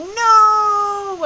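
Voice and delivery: high, loud mock yelp of dread; mock alarmed no. Retelling a hiccup coming back, a mock alarmed no. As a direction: cry no in mock panic.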